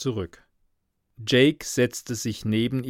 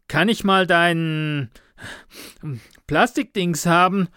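halting, winded request, higher and louder; panting while asking; out of breath; 6 semitones higher, 4 dB louder than usual. A narrator's reading. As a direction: break off for air, groping for the word. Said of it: "der immer mehr außer Atem geriet".

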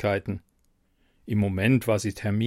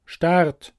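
loud and clear; 6 semitones higher, 5 dB louder than usual. Acted. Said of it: "sagte dann laut und deutlich".

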